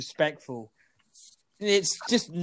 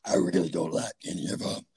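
imitating Doc Rivers. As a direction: drop it down into a rough growly impression. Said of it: "Is that what he sounds like?".

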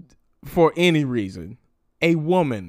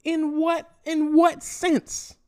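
much higher, slowed, stretched question; incredulous; 13 semitones higher, slower than usual. Retold or imitated. Reacting to his demand for an apology, high slow disbelief. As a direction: drag out the disbelief. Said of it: "And I'm like".

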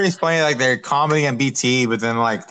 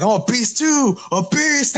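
mock screaming; 11 semitones higher, slower than usual. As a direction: scream it like a wild wrestler hyping himself. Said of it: "he's all, like, screaming and stuff".

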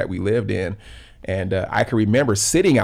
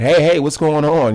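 raised, higher alarmed call of a mom; 6 semitones higher, 8 dB louder than usual. Retold or imitated. Mom running outside, calling out to the boys, alarmed.

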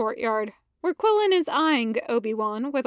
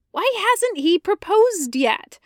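higher, louder fretting question; whiny Anakin; 7 semitones higher, 4 dB louder than usual. Acted. Anakin to Ahsoka, a higher fretting voice. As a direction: fret like an impatient friend.

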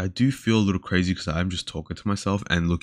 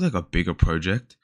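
ordinary talk

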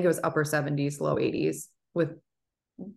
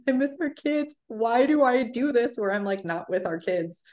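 higher, mildly teary imitation of herself; retold crying; 7 semitones higher than usual. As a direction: replay the tearful complaint, a touch of sob in the voice. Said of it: "I went up to Aaron crying".

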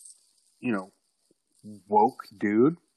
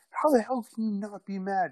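high, indignant female voice; girlfriend voice; 10 semitones higher than usual. Retold or imitated. Girlfriend demanding why he is not mad, high and indignant. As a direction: make her sound outraged.

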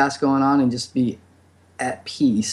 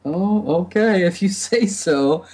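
higher-pitched, drawn-out "oh, okay"; retold hesitant acceptance; 7 semitones higher, slower than usual. Speaker recalling their own reaction to being told, retold hesitant acceptance. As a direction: imitate going along with it, slow and high. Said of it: "once I was like".

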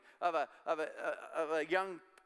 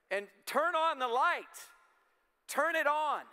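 preaching at full force; 6 semitones higher than usual. Acted. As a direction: lift the congregation into praise. Preaching climax on praise.

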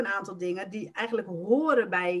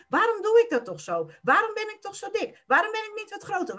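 exasperated self-criticism; 10 semitones higher, faster than usual. Retold or imitated. Voicing inner self-critical thoughts.

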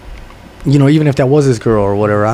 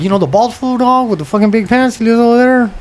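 higher pitched, playful made-up voice of someone asking around; mock questioning; 8 semitones higher than usual. Asking neighborhood locals about the homie, lightly mocking voice. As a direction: play a guy asking locals about the homie, half joking.

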